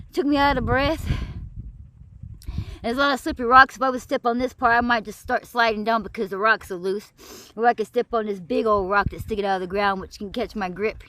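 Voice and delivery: ordinary delivery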